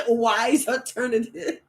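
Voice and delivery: slow, through a laugh. Real, not acted. Seller plugging own teas to viewers, slow and laughing. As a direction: stretch the words while chuckling.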